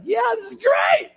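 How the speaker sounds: very high, over-bright fake cheer; mock enthusiasm. Preacher mocking himself welcoming every trial, mock enthusiasm. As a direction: squeal it in hammed-up delight.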